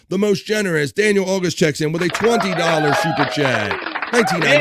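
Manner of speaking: high, loud announcer hype for a big donor; hype announcement. Real, not acted. Host hyping up a generous super chat, excited announcer call. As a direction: sell the donation like a game show host.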